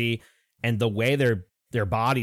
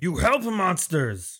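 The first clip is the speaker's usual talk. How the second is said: high, slow, accusing lizard voice; lizardfolk accusation; 9 semitones higher, slower than usual. Acted. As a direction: point the finger in broken speech. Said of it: "And he's like".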